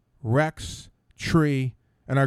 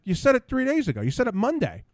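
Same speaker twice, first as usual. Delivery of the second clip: pitched well up, pressing the point; indignant retort; 10 semitones higher than usual. Retold or imitated. Arguing with the mask lady, indignant and raised. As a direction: insist she said it, voice climbing.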